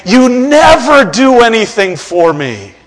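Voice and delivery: put-on child accusing a parent, growing to an indignant yell; complaining child. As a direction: play the hurt kid yelling at a parent. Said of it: "and you claim".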